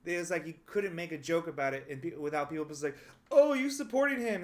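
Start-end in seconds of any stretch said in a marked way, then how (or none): 3.3-4.4 higher, louder, accusing jab; mock accusation. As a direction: imitate a shocked, accusing critic. Mocking an accusing audience member.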